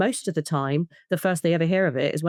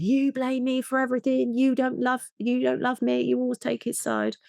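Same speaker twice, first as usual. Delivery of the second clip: mock rage; 8 semitones higher than usual. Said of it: "could go into rage".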